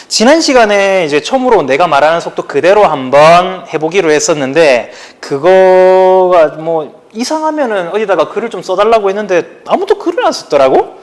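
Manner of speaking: ordinary delivery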